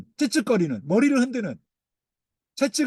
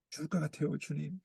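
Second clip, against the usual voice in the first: despairing murmur; 2 semitones lower, 14 dB quieter than usual. Acted. A sinking soul moaning to the Lord, very soft. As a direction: barely get the words out, crushed. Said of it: "이런 거죠".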